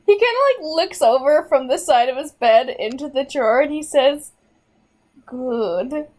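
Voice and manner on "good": slow, sinister mastermind approval; supervillain voice. Acted. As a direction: purr it like a villain whose plan worked. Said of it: "You sounded like a supervillain".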